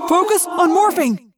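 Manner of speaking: loud, high commanding shout; urgent leader's yell; yelled order. A narrator's reading. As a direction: shout to rally the team. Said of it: "Jake yelled".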